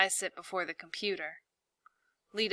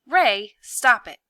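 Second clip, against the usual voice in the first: scolding shout; 4 semitones higher, 11 dB louder, slower than usual. Acted. Scolding bouncing brother.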